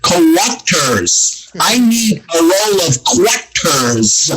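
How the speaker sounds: slow, exaggerated Filipino accent. Shown as a demonstration. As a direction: imitate a careful Filipino accent, stretching the words. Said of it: "they say".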